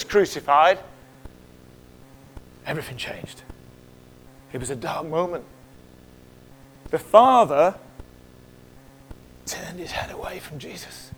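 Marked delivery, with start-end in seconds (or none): 2.7-5.4 awed whisper. Real, not acted. Preacher whispering the crucifixion's turn. 9.5-11.1 sorrowful whisper. Real, not acted. Preacher whispering to the congregation. Father turning away.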